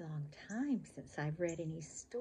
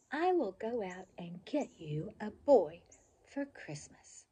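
put-on bright, lively storybook reading for kids; reading to children; 4 semitones higher, 14 dB louder than usual. A narrator's reading. Reading a favorite childhood book to children, lively storybook voice. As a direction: read it like a bedtime picture book, full of expression.

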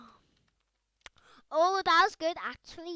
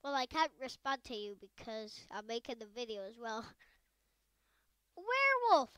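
ordinary talk, nothing marked

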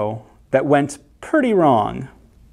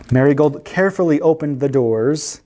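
plain reading voice

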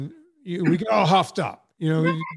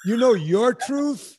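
higher and louder, slow incredulous question; mocking disbelief; 6 semitones higher, 4 dB louder, slower than usual. Host mocking the friend's claim to her own truth, slow and disbelieving. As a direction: toss her phrase back with scorn.

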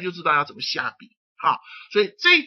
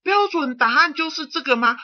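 high-pitched, louder student asking the teacher; questioning student; 9 semitones higher, 5 dB louder than usual. Acted. Student asks teacher about the standard answer, questioning. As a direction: act an eager student checking with the teacher. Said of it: "你说".

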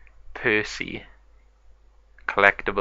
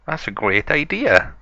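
light, higher mum voice for a toy pig; Mommy Pig voice; 7 semitones higher than usual. Acted. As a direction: play the mother pig, a bit higher and sweet. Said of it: "Mommy Pig said".

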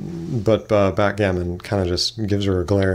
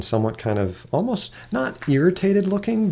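ordinary talk, nothing marked

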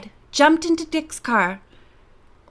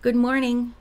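ordinary delivery, nothing marked

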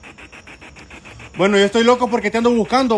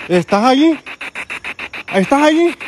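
loud, high call into the dark; probing call; 5 semitones higher, 4 dB louder than usual. Real, not acted. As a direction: call out to find the spirit. Calling out to a spirit.

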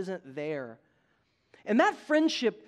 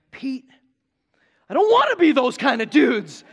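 retold protest; 3 semitones higher, 11 dB louder than usual. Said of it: "And I was like".

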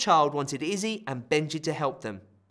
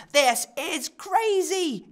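exasperated; 14 semitones higher, 5 dB louder, much slower than usual. Acted. Amid the chaos.

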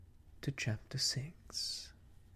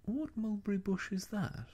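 puzzled bear; 9 semitones higher, faster than usual. A narrator's reading. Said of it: "and then asked".

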